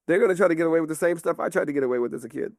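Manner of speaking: ordinary delivery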